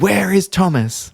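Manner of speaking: high, slow demanding question; demanding boss. Retold or imitated. Boss demanding the teacher's whereabouts, high and slow. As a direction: snap it out like a boss on the hunt. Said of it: "she immediately said".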